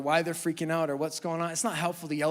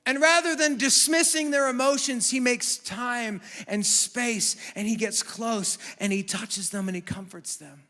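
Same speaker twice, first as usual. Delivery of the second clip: loud, high, impassioned preaching, slowing on key phrases; full-force preaching; 10 semitones higher, 11 dB louder than usual. Acted. Pastor to the congregation, preaching at full force. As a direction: preach it out to the whole room, full voice.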